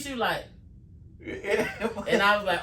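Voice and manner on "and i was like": ordinary talk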